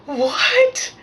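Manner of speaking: high, loud disbelieving cry; shocked. Retold or imitated. Renter told who owns the house, high shocked question. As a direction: squeal it in disbelief.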